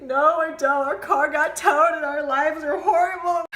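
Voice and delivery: loud, high, half-laughing cry; laughing through tears. Retold or imitated. Telling the tow story, loud laughing-crying quote. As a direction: blurt it out, laughing while upset. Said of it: "I was like".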